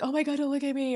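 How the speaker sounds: lightly voiced flustered parent; mock panic. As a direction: squeal it as a flustered parent would. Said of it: "I was never like".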